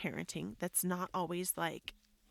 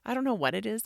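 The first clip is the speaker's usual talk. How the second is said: ordinary delivery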